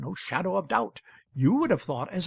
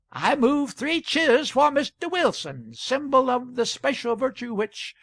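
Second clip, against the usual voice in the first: high, loud proposal to a crowd; rally the whole hall to cheer; rousing call; 7 semitones higher, 4 dB louder than usual. A narrator's reading. Hatter moving three cheers for Wilson, rousing raised voice.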